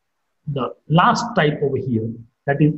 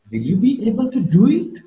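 "do it" pitched up high, suspicious; doubtful question; 7 semitones higher than usual. Shown as a demonstration. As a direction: question their ability, swinging up on "do it". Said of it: "I'm showing a doubt and a suspicion".

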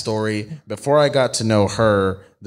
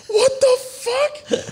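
much higher, slow and stretched, aghast; stunned disbelief; much slower than usual. Retold or imitated. Retelling to friends how he reacted, stretched high disbelief. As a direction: act floored by an absurd accusation. Retelling shock at girl's accusation.

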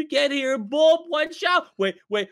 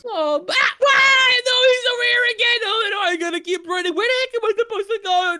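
loud, high, rushed panic; shriek, then gabble fast and high; screaming like a girl; 9 semitones higher, 5 dB louder than usual. Acted. Player finding the monster behind him, frantic screaming. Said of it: "probably gonna scream like a little girl".

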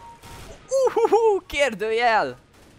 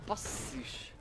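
whisper; 14 dB quieter than usual. Real, not acted. Quiet curse during play.

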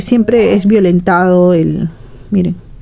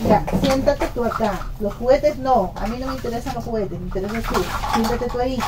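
tender to her daughter; 2 semitones higher, 10 dB quieter than usual. Real, not acted. Mother coaxing daughter to sit.

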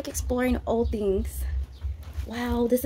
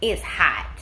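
ordinary delivery

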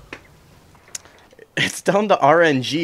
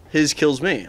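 ordinary talk

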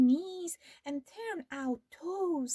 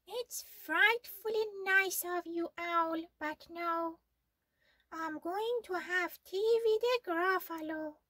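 higher mouse voice, prim refusal; polite little mouse; 7 semitones higher than usual. Acted. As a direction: decline with mock manners.

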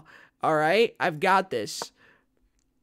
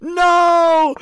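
much higher and louder cry; shocked yell; 13 semitones higher, 16 dB louder than usual. Real, not acted. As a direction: yell it in disbelief.

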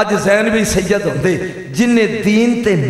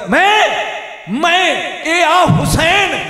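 high, slow wailing shout; impassioned preaching; 10 semitones higher, slower than usual. Acted. Preacher voicing Husain's offering, high slow wail. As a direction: wail it out at the climax. Climax of the majlis narration.

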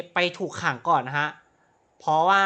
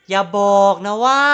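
much louder, rising with discovery; excited gasp; 3 semitones higher, 11 dB louder than usual. Real, not acted. Gamer finds a gun nearby, loud excited blurt. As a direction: blurt it as you spot the gun.

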